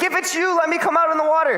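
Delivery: high, fast, excited plea; bursting to jump out of the boat; eager Peter. Retold or imitated.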